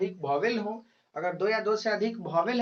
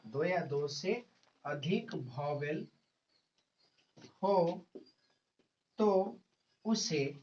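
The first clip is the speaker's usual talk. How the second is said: word by word, long pauses for note-taking; teacher dictating slowly to students; slow dictation; 3 semitones lower, 3 dB quieter, slower than usual. Acted. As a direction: dictate a definition in small, measured chunks. Dictates a definition for notes.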